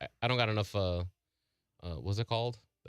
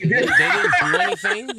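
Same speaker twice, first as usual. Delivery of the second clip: louder, higher, laughing through the words; 13 dB louder, slower than usual. Real, not acted. Recapping a disappointing episode to friends, laughing. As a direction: laugh it out in disbelief.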